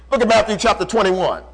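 normal talking voice

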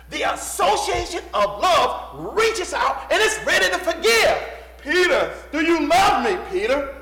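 high, loud pulpit cry; full-force preaching. Real, not acted. Preacher on Jesus reaching out to Peter, impassioned. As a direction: call out forgiveness with fervor.

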